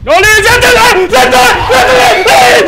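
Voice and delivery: high, loud scream of warning; real rising panic; panicked shriek. Real, not acted. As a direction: scream that it's coming. Monster approaches in game.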